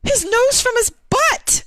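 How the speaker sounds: furious rant. Real, not acted. Streamer venting at the UN chief, high furious shouting. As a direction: rage at the official, voice cracking up high. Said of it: "I'll calm down".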